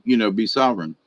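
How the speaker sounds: ordinary talk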